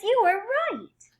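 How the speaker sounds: normal talking voice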